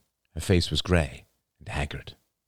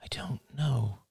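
whispery, higher, faraway murmur; distant murmur; 10 semitones higher, 4 dB quieter than usual. A narrator's reading. Daughter answering her father's question, murmuring distantly. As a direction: murmur it as if lost in thought. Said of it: "murmured Rachel distantly".

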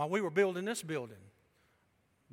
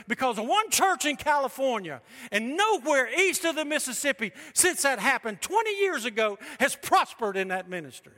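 loud, driving preacher rant; preaching; 4 semitones higher, 6 dB louder than usual. Acted. Pastor preaching against church trends, loud and driving. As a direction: hammer it home with full force.

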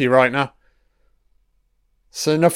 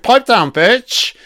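higher mock-tough "Pipe down, bitch" at normal speed; quick, higher jeering "Pipe down, bitch"; mock tough guy; 7 semitones higher, 4 dB louder, slower than usual. Mocking a TV character.

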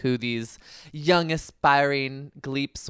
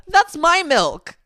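high-pitched, drawn-out mock protest; cafeteria kid; 6 dB louder, much slower than usual. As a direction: play an indignant kid guarding one line. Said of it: "the kid in the cafeteria".

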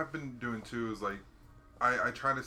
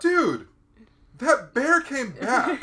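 much higher, louder, slow amazed dude; astonished caller; 13 semitones higher, 12 dB louder, much slower than usual. Retold or imitated. Owner tells the worker the bear returned, slow and high. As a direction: stretch it out in disbelief. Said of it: "He's like".

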